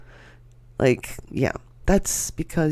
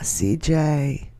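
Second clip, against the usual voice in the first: ordinary talk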